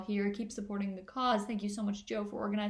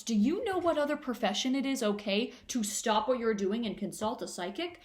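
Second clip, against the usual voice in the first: usual speaking voice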